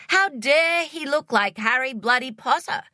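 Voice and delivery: usual speaking voice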